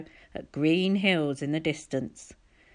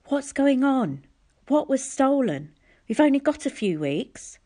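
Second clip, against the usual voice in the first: put-on a worried camp girl pushing for answers; anxious girl; 8 semitones higher than usual. Curly-haired girl pressing the sick boy, high and anxious.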